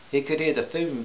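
ordinary delivery

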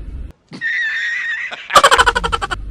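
shrieking laugh; high squealing laugh. Real, not acted. Laughing after directing camera.

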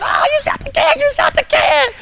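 very high, loud shout of delight; excited cheer. Retold or imitated. Two boys cheering when the can flips, excited high shout. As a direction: yell it like you can't believe he hit it.

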